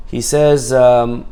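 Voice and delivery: ordinary talk, nothing marked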